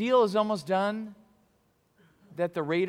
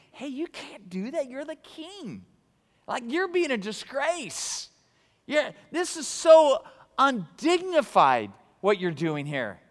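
higher-pitched scolding; scolding wife; 7 semitones higher, 3 dB louder, slower than usual. Retold or imitated. Wife reminding her king husband of his dignity, scolding. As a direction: rebuke him like an embarrassed wife. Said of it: "his wife reminded him, saying".

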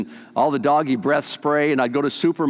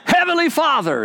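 loud, much higher cry to heaven; shouted prayer; 11 semitones higher, 3 dB louder than usual. Retold or imitated. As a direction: belt it out like a shouting preacher. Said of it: "one of those shouters".

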